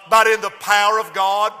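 ordinary delivery

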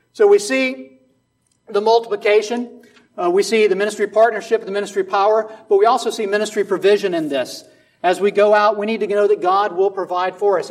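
loud, high preaching to the congregation; full-force preaching. Acted. Preaching builds again.

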